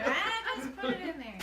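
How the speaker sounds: mock Grandpa. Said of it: "That's what he said".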